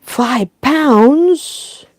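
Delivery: incredulous scoff at the price; snorting in disbelief. Acted. Daddy Pig balks at the moon cheese price, snorting. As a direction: scoff at it like a stingy dad. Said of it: "snorts Daddy Pig".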